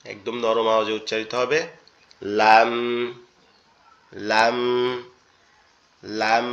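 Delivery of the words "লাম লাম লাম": লাম said softly, tongue tip to the palate; লাম repeated with a soft clear l; soft lam demo. Shown as a demonstration. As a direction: model লাম with a soft, light l. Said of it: "এরকম ভাবে আওয়াজটা আসবে".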